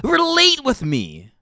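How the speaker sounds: usual speaking voice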